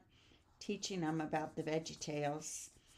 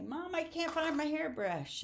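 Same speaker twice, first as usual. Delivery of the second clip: daughter; 8 semitones higher than usual. Retold or imitated. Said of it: "She'd say".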